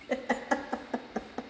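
airy giggle after a warning; breathy laugh. Real, not acted. Laughing at her own warning to viewers.

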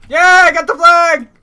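loud, high triumphant yell; excited shout. Real, not acted. Grabs flag in match, excited yell.

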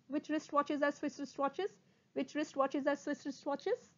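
quick, even repeats, rising end; sped-up tongue twister. Shown as a demonstration. Teacher speeds up the twister for students, quickly. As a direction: run the words together. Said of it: "speed up".

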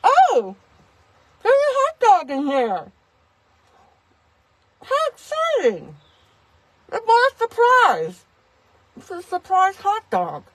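very high, hammed-up squeal of delight; delighted dinner guest. Guests finding the hidden hot dog, mock-delighted. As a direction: play an over-excited guest in a high put-on voice. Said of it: "And then they'll be like".

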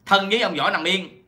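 ordinary delivery

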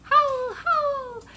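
high, drawn-out howl; wolf howl. Shown as a demonstration. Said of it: "wolves howling".

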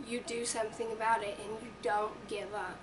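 normal talking voice

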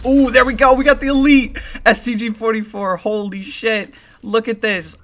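high-pitched gleeful amazement; excited celebration. Real, not acted. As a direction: voice jumps up in real delight. Pulls elite gun.

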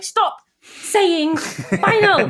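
high, very slow, drawn-out whine; mock pleading. Wife begging her husband to stop saying final, drawn-out high whine. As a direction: stretch it out like a playful plea.

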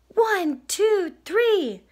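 high, evenly spaced count; storybook cub awaiting a surprise; expectant little bear. Acted. Little Bear counts with eyes shut.

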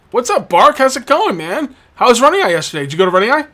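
high, loud, friendly banter with a customer; cheery shopkeeper. Acted. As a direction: greet the regular with big enthusiasm.

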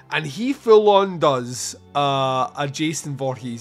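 ordinary delivery

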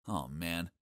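plain reading voice

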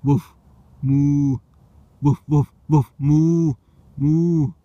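loud, high woofs rising into mooing; bark, then moo loud at the worms; dog then cow noises. Pretend.

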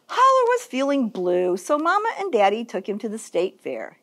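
usual speaking voice

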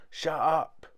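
usual speaking voice

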